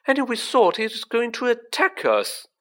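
high relieved voice; giddy relief. Acted. Ron relieved, laughing off fear.

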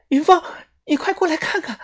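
high urgent call. A narrator's reading. Xiaoxuan calls Yunfang over to the wound, urgently. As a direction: call her over in a hurry. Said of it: "小轩大声地叫我过去".

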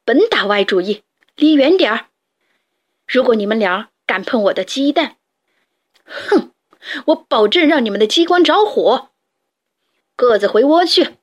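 a touch of a furious father; angry threat. Acted. Father rooster threatening the boys, mildly angry. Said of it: "迪克气哄哄地跑过来".

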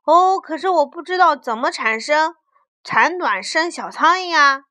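loud protest. A narrator's reading. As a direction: protest loudly as the puzzled child. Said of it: "大声地说".

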